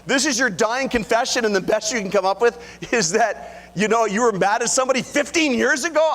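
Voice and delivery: high, loud mock disbelief; playfully outraged at the tiny confession; incredulous. Husband teasing his dying wife's confession, high incredulous disbelief. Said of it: "I was like".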